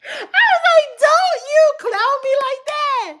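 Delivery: high, laughing mock protest. Telling the guy at the event not to clown her, laughing high. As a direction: squeal it out through laughter.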